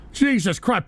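usual speaking voice

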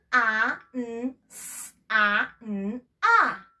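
"a, n, s, a" split sound by sound; slow letter by letter; sounded out again. Shown as a demonstration. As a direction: repeat the segmented sounds. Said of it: "A ver, escuchemos".

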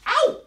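sudden high, loud "ow"; comic yelp of pretend pain; mock pain yelp. Stretching a balloon for children, hammed-up pained yelp.

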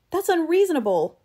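high, loud objection; desperate protest. Acted. Eccles objecting to reaching into the monster, desperate protest. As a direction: protest in alarm, pitch shooting up.

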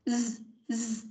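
quiet buzzing "Z", throat vibrating; voiced z. Shown as a demonstration. Teacher showing how z vibrates in the throat. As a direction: hum "Z" in the throat. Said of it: "Con bỏ tay ở trên cổ ý".

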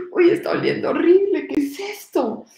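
alarmed grandpa. Acted. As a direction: let grandpa recoil from the stink. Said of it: "empieza el abuelito". (abuelito alarmado y asqueado, más fuerte)

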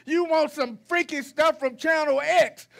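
ordinary delivery

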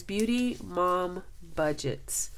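slowly reading the name, savoring it. Real, not acted. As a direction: spell it out slowly in disbelief. Reading her channel name aloud.

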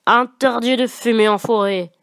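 ashtray guard growling a warning; growled. A narrator's reading. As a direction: growl it like a grumpy forest guard. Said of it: "L'un d'eux grogna".

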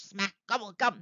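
ordinary delivery

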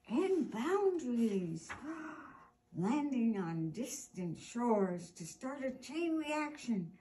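lively, expressive storybook read-aloud; animated picture-book reading for young listeners; reading to children. Acted. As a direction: read it like story time for little kids.

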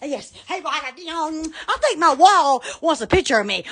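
normal talking voice

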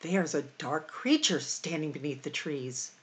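ordinary talk

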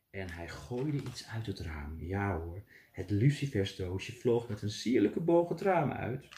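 plain reading voice

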